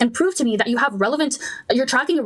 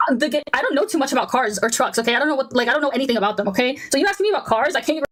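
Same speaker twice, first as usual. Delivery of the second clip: ordinary talk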